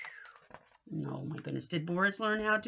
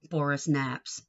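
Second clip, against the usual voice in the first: usual speaking voice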